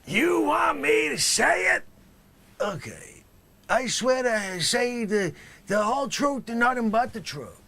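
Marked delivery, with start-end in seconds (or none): 3.7-7.6 lazy dealer swearing in; flat mumbled oath. Acted. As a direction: mumble it like a stoned witness. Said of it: "that'll do".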